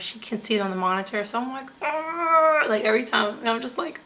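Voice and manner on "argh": high, loud "argh" of pain; re-enacted labor groan, pitched up; imitated pain groan. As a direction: groan through an acted contraction. Imitating groan at each contraction.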